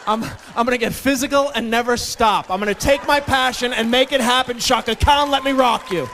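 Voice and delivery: loud, high, pumped declaration; hyped-up eighties shout. Retold or imitated. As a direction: belt it like an eighties anthem. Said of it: "in nineteen eighty-four I said".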